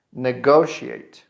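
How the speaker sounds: slow "negotiate" for copying; slow repeat-after-me model. Shown as a demonstration. Teacher has learners repeat with her, slow "negotiate". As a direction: say "negotiate" slowly, ne-GO-she-ate. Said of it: "Repeat it with me".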